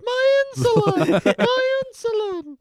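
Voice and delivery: squeaky cartoon whine; high squeaky voice. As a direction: pitch way up, drawn out. Repeating the insulin bit.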